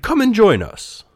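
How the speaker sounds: high-pitched eager invitation; high, gleeful puppet voice; excited cry. A narrator's reading. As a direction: call out like thrilled puppets. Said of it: "they cried".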